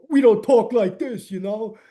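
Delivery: hammed-up New York accent; bad Jersey/New York accent. Shown as a demonstration. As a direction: exaggerate a thick Jersey accent. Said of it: "bad Jersey slash New York accents".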